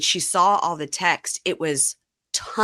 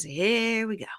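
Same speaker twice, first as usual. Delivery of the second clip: drawn-out imitation, a bit higher; put-upon mock dread; resigned; 5 semitones higher, 4 dB quieter, slower than usual. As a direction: brace wearily for the drama. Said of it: "he literally goes".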